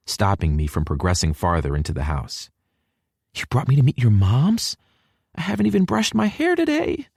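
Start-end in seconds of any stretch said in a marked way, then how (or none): 3.3-7.1 put-on higher young woman's voice, flustered; Hallie's voice. A narrator's reading. Girlfriend panicking about meeting his mom, higher and flustered. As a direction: play the flustered girlfriend, pitched up.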